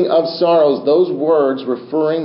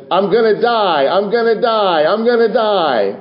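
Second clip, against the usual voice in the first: higher, imitated panicked pleading; imitating a pleading woman; 7 semitones higher than usual. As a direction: echo a laboring woman's cries, half-mocking. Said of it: "one lady begging and pleading".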